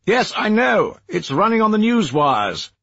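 thin voice down a phone line; like over a crackly line. Acted.

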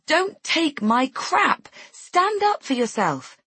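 high, drawn-out exasperated outburst; exasperated yell, held short of full volume; yelled inwardly. A narrator's reading. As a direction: punch it out slowly, pitched well up. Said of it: "I want to yell".

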